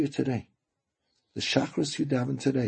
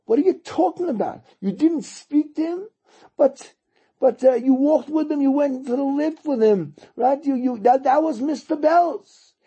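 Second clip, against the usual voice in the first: incredulous; 8 semitones higher than usual. Retold or imitated. Brother, amazed, questioning rabbi.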